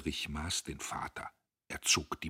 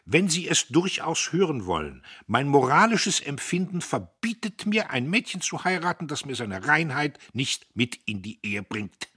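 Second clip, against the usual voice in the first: much higher and louder moral pronouncement; self-righteous; 9 semitones higher, 9 dB louder than usual. Acted. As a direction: preach his morals at him. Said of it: "Umso entschlossener ging er vor". (selbstgerecht und laut)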